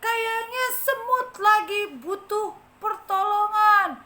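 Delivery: high, slow, loud onlooker; passerby voice. Acted. A passerby notices the struggling ant. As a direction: announce slowly that help is needed. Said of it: "ada yang lewat bilang gini".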